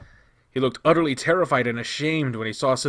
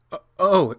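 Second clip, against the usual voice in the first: startled; 8 semitones higher, 8 dB louder than usual. Acted. Caught off guard on phone.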